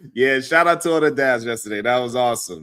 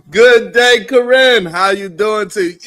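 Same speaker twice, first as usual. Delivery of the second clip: excited greeting; 8 semitones higher, 8 dB louder than usual. Real, not acted. Host greets viewer by name.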